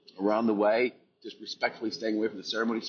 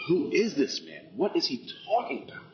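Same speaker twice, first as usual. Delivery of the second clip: higher-pitched, disbelieving questions; mock incredulous; 7 semitones higher than usual. Recalling doubts about Eric's hippie talk, mock incredulous. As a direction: replay your baffled inner skeptic. Voices his earlier skeptical thoughts.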